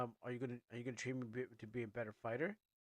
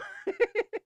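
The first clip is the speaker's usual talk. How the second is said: high giggle; 14 dB louder than usual. Real, not acted. Giggle between takes of line.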